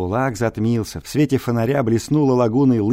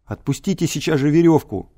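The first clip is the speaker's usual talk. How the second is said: shouting; 6 semitones higher, 3 dB louder than usual. Acted. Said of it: "закричал я".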